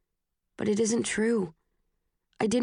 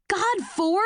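much higher angry snap; exasperated outburst, pitched way up; 11 semitones higher than usual. Acted. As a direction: snap his name in frustration. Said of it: "I snap".

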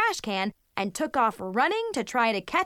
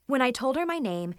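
ordinary delivery

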